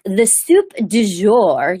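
slow, drawn-out pretentious French menu item; stuffy, pretentious. Acted. Restaurant waiter announcing the soup in French, stuffy and pretentious. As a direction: announce the dish with mock grandeur. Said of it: "so stuffy and pretentious".

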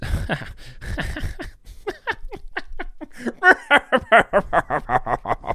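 very high cartoonish cackle; long, silly laugh that rattles down; absurd, drawn-out comic laughing noise; Jim Carrey-like laugh. Real, not acted. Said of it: "Now you sound like Jim Carrey".

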